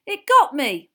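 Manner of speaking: loud, high cry of alarm; pained yell. A narrator's reading. Dean telling Hagrid he's hurt, yelling. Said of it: "yelled Dean Thomas".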